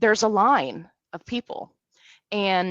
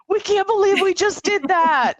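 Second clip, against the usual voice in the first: high, loud giddy exclamation; bursting with glee after the stunt; thrilled disbelief; 12 semitones higher, 6 dB louder than usual. Retold or imitated. The family leaving after sneaking in, loud giddy disbelief. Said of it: "we were all like".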